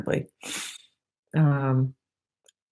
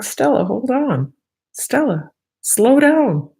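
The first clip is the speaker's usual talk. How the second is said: calling the dog; 7 semitones higher, 4 dB louder than usual. Retold or imitated. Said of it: "and I'm like".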